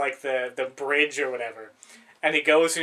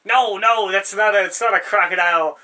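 higher, louder exasperated scolding; parents scolding; 5 semitones higher, 9 dB louder than usual. Retold or imitated. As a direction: rush in to calm the kid and scold the brother. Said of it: "they're like".

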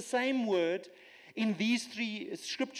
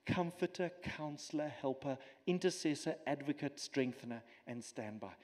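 softer, lower, "comforter" to "standby" fading away; trailing reverent recital, down to a murmur on "standby"; quiet awed list; 5 semitones lower, 5 dB quieter than usual. Real, not acted. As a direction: let the titles sink into hushed wonder. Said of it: "I will not be able to unpack".

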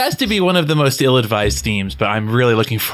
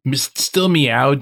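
ordinary delivery

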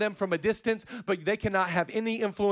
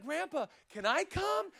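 put-on high, soft voice of a pleading kid; grandchild asking; 8 semitones higher, 7 dB quieter than usual. Grandchild asking grandpa to come along, high and soft. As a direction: ask softly and high, imitating a child. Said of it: "I'm like".

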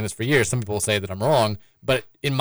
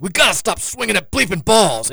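raised-voice retelling of a yelling coach; mock coach yell; 8 semitones higher, 4 dB louder than usual. Baseball coach yelling at the bench, mock yell. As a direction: imitate an angry coach, pitched up. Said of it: "when he got mad, would just, like, yell at the bench".

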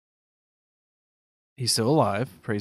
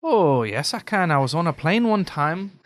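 lilting, higher-pitched Irish accent; 7 semitones higher, 4 dB louder than usual. Shown as a demonstration. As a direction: slip right into a singsong Irish brogue. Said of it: "Can you go right into it".

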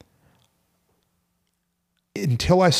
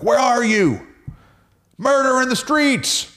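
yelling at God; 13 semitones higher, slower than usual. Retold or imitated. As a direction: cry out to heaven, pitched way up. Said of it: "He's literally yelling".

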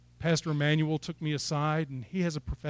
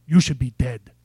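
put-on loud, low Nigerian-accented pronouncement; 4 semitones lower, 12 dB louder than usual. Pastor Emmanuel pulling the preacher close, Nigerian accent. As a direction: imitate a Nigerian pastor, deep and forceful. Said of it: "he has that, that wonderful accent".

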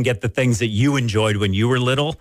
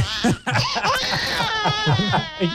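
much higher mock crying wail; imitated toddler wail. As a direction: wail like a three-year-old opening a present. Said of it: "it sounded much like that".

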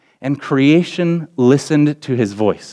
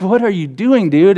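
higher, exasperated question; what-are-you-doing disbelief; 6 semitones higher than usual. Retold or imitated. Disciples to sleeping Jesus, high and exasperated.

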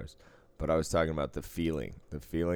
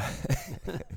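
high breathless laugh; 12 semitones higher than usual. Real, not acted. Laughing at the joke.

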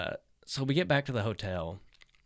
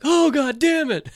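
much higher, louder, slow outraged groan; disgusted yell; 12 dB louder, slower than usual. Retold or imitated. Imitating his friend recoiling from the smell, loud and outraged. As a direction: bellow it as the stink hits. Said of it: "he's like".

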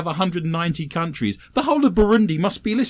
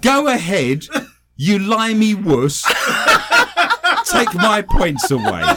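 put-on slow, laughing Irish accent; hammed-up Irish accent; 5 semitones higher, 4 dB louder, slower than usual. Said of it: "read your first story out in an Irish accent".

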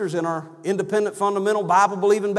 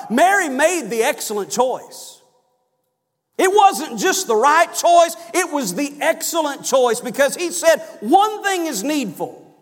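higher, slower, emphatic preaching on the excellent choice; rising preaching; 8 semitones higher, 4 dB louder than usual. Acted. Preacher urging his congregation toward Mary's choice, fervent. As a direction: drive home the excellent choice. Preacher building to full force.

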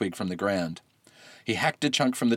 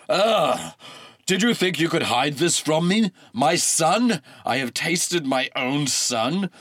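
louder, higher enraged god; furious god who tasted his son; enraged scream; 5 semitones higher, 4 dB louder, slower than usual. A narrator's reading. Pallas screaming at the mortals in horror and rage. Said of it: "screamed Pallas".

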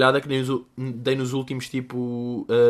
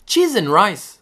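loud high "Cheese and rice." like a prim churchgoer; prim substitute oath; 7 semitones higher, 7 dB louder, much slower than usual. Shown as a demonstration. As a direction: chirp "Cheese and rice." high and bright as a polite curse. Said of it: "há pessoas que dizem".

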